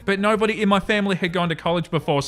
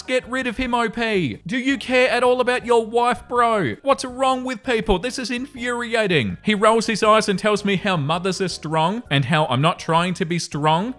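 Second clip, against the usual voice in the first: ordinary talk, nothing marked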